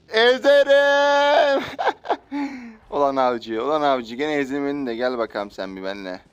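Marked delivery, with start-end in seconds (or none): 0.1-1.6 slow high drawn-out threat; mock villain threat, very slow and high; hammed-up threat. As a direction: stretch every sound like a cartoon villain. Drawn out threat while driving.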